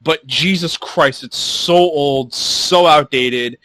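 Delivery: slow, drawn-out exasperation; exasperated emphasis. Real, not acted. Podcaster griping about an outdated streaming site, slow and exasperated. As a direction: drag out each complaint for emphasis.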